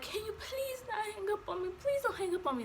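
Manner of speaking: high, soft begging, retold; emotional pleading. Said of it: "I'm just in an emotional state".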